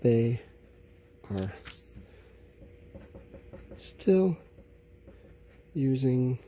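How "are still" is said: ordinary delivery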